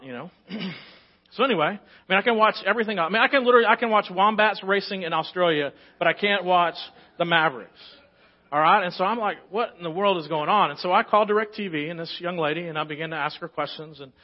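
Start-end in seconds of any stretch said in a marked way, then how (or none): 1.3-12.4 loud, high exasperated telling; fired-up preacher venting about missing the game; worked up. Real, not acted. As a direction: let the frustration boil over. Pastor's worked-up story of sports channel.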